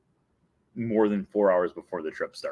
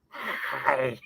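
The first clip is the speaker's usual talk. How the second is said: softer failed Stitch hi; Stitch impression; 2 semitones higher, 5 dB quieter than usual. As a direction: give up halfway on Stitch. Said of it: "I can't do it anymore".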